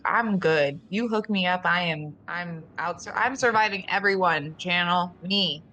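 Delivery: ordinary delivery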